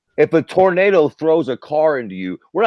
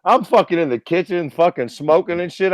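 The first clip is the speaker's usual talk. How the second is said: ordinary delivery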